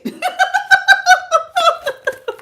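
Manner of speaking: long, high-pitched giggling fit; real high laughter in a run of bursts; high cackling laugh. Real, not acted. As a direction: crack up helplessly. Cracking up at what viewer said.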